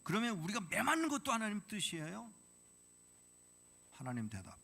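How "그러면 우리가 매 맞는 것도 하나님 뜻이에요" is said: 높고 크게 따지는 할아버지; 하나님께 화내며 항의하기; angry protest. Retold or imitated. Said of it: "이 할아버지가 화가 나서". (화가 나서 크게 따지는 할아버지)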